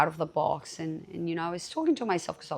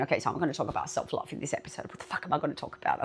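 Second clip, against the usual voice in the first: rushed inner thought; 3 semitones lower, 6 dB quieter, much faster than usual. Retold or imitated. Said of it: "obviously I'm thinking".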